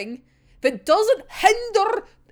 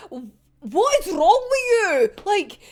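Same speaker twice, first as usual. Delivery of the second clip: louder and slower, what is wrong with you; exasperated outburst; 6 semitones higher, 4 dB louder, slower than usual. Real, not acted. Fan ranting at the villain, slow exasperated outburst. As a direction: drag it out, disgusted and fed up. Furious rant at character.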